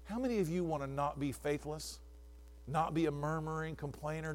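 ordinary delivery

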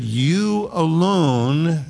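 "You alone" drawn out very slowly; a slow, weighty "You alone". Shown as a demonstration. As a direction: stretch out "You alone" word by word. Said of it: "it's emphatic".

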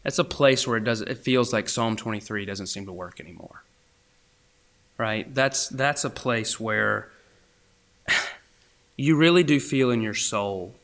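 ordinary talk

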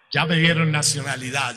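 ordinary delivery

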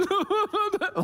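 high-pitched mock sobbing wail; imitated child's wail. Dad imitating his melting-down son, high wail. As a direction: mimic a little boy bawling. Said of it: "the wailing ghost sound".

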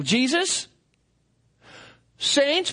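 ordinary talk

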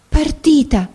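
a high, stunned echo of the word; the girl hears her mistress has gone, dismayed disbelief. A narrator's reading. Partita? thrown up high in shock. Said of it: "ripeté sgomenta la barberina".

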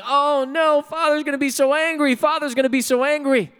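put-on high, loud wail of a panicking boy; mock panicked scream. Boy who tipped the hay cart over, mock screaming. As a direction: imitate a boy crying out in dismay. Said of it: "the boy's screaming".

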